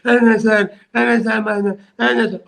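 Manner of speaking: high, fawning mock of a cringing official; a mocking imitation of a fawning subordinate; servile yes-man. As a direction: cringe and bow your voice to the big man.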